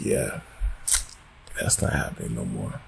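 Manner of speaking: low, soft resigned aside; resigned mutter. Retold or imitated. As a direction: mutter it, giving up. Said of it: "you're like".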